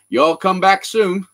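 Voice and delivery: slow, drawn-out Texan-style American; American accent. Acted. Brit showing his one American phrase, Southern American accent. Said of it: "this is the only American thing I know".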